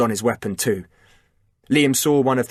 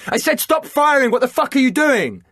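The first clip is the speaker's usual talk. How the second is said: much higher, loud angry yell; furious shout; 5 dB louder than usual. A narrator's reading. Liam raging at Chris for firing, furious shout. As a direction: lose it at the disobedient soldier. Commander furious at disobeying soldier.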